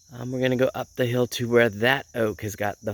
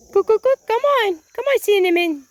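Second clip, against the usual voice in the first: loud falsetto cooing call; calling a chicken; 7 dB louder than usual. Real, not acted. Owner calls Cinnamon out of hiding, high loud call.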